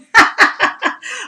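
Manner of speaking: burst of laughter. Real, not acted. Teacher laughs at viewer Diana watching on phone, real laugh. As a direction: laugh out loud at the confession.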